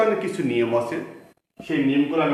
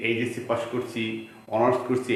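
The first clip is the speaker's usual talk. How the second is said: normal talking voice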